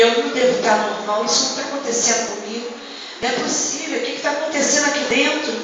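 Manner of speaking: very soft, stunned self-questioning; hushed disbelief. Retold or imitated. Mother doubting her senses at the hospital, hushed disbelief. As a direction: retell it as if talking to yourself.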